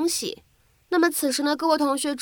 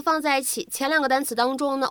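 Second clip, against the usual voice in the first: ordinary talk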